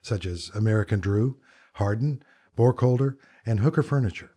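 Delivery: smooth announcer read over the music; podcast host intro. Acted. Host opening his design podcast for listeners, polished announcer read. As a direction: present the show and the guest like a radio host.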